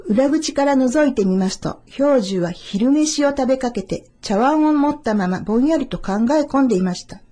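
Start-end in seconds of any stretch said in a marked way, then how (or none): none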